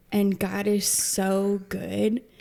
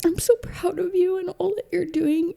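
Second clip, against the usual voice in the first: high imitation of the daughter, a bit more measured; daughter's voice; 9 semitones higher than usual. Daughter telling her mom she's proud, pitched high. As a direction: keep the daughter's raised pitch as she goes on. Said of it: "she's like".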